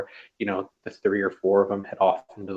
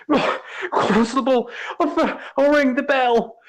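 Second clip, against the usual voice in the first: coughing Lionel. A narrator's reading. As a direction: gasp it out after the smoke. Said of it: "He's coughing".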